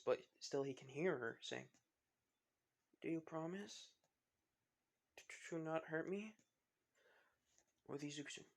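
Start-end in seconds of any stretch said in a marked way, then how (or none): 3.0-6.3 small, soft, hesitant question; very quiet voice. Acted. Frightened girl to a stranger, very quiet voice. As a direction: ask it timidly, barely raising the voice. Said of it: "in the most quietest voice".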